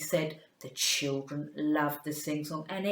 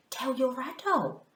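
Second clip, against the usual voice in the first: usual speaking voice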